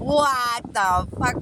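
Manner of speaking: high, drawn-out incredulous cry; stunned disbelief. Real, not acted. Storyteller still stunned by the customer's remark, drawn-out disbelief. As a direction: stretch each word in disbelief.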